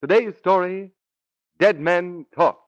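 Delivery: ordinary talk, nothing marked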